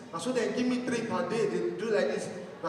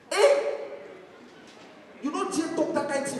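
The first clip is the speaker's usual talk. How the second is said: outraged eh; 5 semitones higher than usual. Retold or imitated. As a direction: explode in disbelief. Parent outraged reaction.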